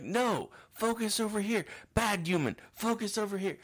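high, loud mock scolding like training a pet; hammed-up stern universe voice. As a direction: scold a naughty dog, sing-song.

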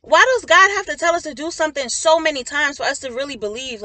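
loud, worked-up exhortation; frustrated. Real, not acted. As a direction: plead with them in real frustration. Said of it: "I'm getting a little frustrated".

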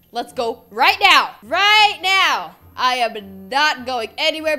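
loud, rising demand on right now; insistent shout. Acted. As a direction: push it up into a shout.

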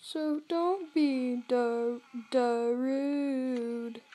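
slow, lightly put-on voice; character voice. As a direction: speak slowly as a sad animatronic. Voicing a game character.

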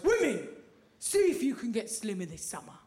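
lightly put-on ad slogan, first word punched; mock advert voice. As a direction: call out to women like an advert. Imitated advertising campaign slogan.

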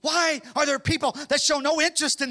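shouting, high-pitched preacher's call; fiery preaching. Real, not acted. Preacher shouting to the church to fast and pray. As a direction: thunder it out to the church.

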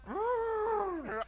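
high, crying elephant roar. Retold or imitated. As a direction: imitate a scared elephant trumpeting. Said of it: "they go".

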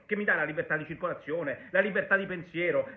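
usual speaking voice

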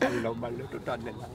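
soft, low mock Indian accent. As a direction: murmur it in a hammy Indian accent. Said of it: "doing an Indian accent".

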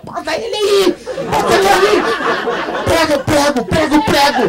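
hammed-up mob screaming for the nails; mocking mob yell. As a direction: bellow it like a frenzied crowd at the cross. Acted crowd demanding the crucifixion.

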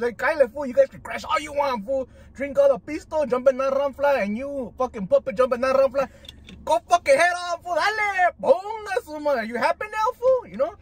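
high, hammed-up rant to imaginary drivers; worked-up excitement. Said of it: "He gets excited".

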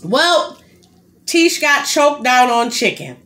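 high, slow dramatic announcement; mock announcement. Real, not acted. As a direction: announce it like breaking news. Announcing her choking mishap.

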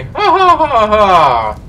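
laughing out loud at himself; a burst of nervous laughter; real laughter. Real, not acted. Gamer laughs at how scared he gets, real laughter.

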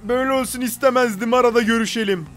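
mockingly quoting the ex-boss's message back at him. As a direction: read the boss's text in a hammed-up high voice. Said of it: "Bu nedir?".

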